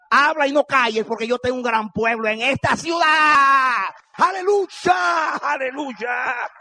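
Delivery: fervent pulpit cry, slow and soaring; full-force preaching. Real, not acted. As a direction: build to a shouted hallelujah and stay up there.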